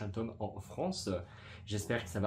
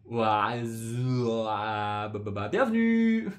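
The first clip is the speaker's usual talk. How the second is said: hyped-up welcome; 7 dB louder, much slower than usual. Pretend. Playful over-the-top welcome.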